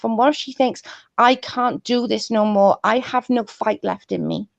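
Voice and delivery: usual speaking voice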